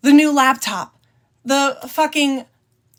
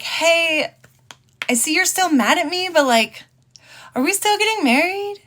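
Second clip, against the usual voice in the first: raised, sweetly coaxing voice; sweet pleading; 6 semitones higher than usual. Retold or imitated. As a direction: coax her gently, pitched up. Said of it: "I was like".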